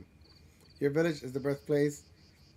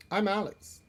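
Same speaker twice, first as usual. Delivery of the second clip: higher, brighter try at the name; higher first take; 6 semitones higher than usual. Acted. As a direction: pitch the name up a bit.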